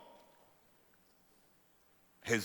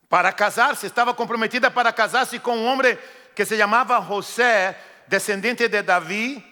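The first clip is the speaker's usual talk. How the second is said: raised, higher storytelling preach; fervent preaching; 5 semitones higher, 7 dB louder than usual. Acted. Preacher to congregation, fervent preaching. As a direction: drive the story home to the church. Preacher reads Luke aloud.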